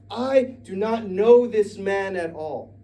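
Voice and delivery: high, loud, slow denial; Peter's final loud slow denial; emphatic denial. Acted. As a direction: spell out each word in denial.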